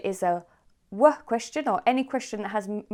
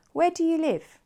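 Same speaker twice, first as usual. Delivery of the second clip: "Where" pitched high, falling to "live"; stress at the beginning; 10 semitones higher than usual. Shown as a demonstration. Teacher models an open question, stressing "Where". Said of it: "the stress is at the beginning".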